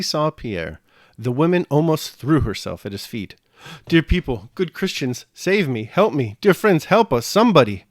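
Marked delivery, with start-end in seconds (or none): 3.9-7.8 high, loud, desperate begging; frantic tearful plea to strangers; pleading through sobs. A narrator's reading. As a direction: beg for help, voice near breaking. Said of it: "she muttered between her sobs".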